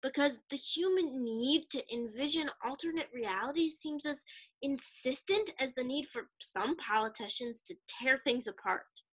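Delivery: usual speaking voice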